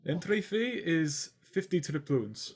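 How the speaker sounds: lightly voiced butler stating terms; prim elf doorman. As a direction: lay out the fee with cool politeness. Elf butler demands entry fee.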